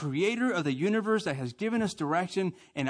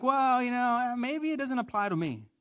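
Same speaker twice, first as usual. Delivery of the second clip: mock excuse-making; 9 semitones higher than usual. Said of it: "We're like".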